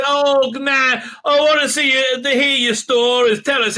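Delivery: high, pleading mock voice; mock whiny companion.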